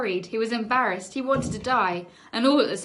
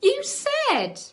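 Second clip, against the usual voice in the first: high, loud indignant squeal; 5 dB louder than usual. Acted. Sharon accusing Charlie, high and loud. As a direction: squeal it in outrage.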